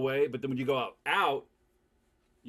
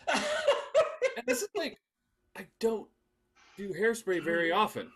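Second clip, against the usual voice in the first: laughing guy quote; 9 semitones higher, 5 dB louder, slower than usual. Retold or imitated. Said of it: "he goes".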